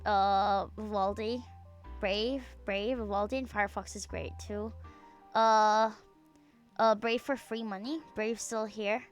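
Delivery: ordinary talk, nothing marked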